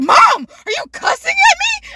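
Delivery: very high mock indignation; shocked daughter. Daughter catching mom cussing at her, shocked. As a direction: squeal it in exaggerated disbelief.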